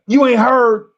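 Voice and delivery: acted disbelief. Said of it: "He like".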